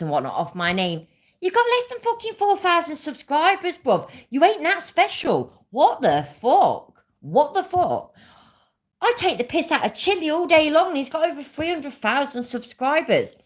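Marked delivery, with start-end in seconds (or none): 1.4-13.3 high-pitched, indignant tirade; incredulous, heated telling-off; angry rant. Real, not acted. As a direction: really lay into a rival, voice pushed right up. Angry rant at a rival YouTuber.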